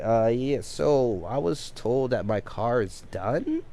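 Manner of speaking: slow, questioning rise on "done?" at the very end; pointed question. Retold or imitated. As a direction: stretch the words out and lift "done?" like a real question. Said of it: "I am doing my best to emphasize the question in my voice".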